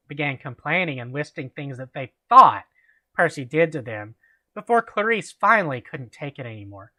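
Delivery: usual speaking voice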